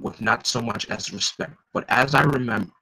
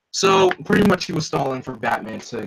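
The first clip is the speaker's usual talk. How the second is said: ordinary delivery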